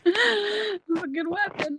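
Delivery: slow words through laughter; giggling it out; laughing. Real, not acted. Wife joking that the stapler is a weapon, laughing.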